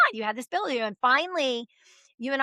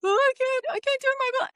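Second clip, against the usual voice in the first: much higher, whiny panicked daughter; mock the frozen teen driver; 14 semitones higher than usual. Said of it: "And you're just like".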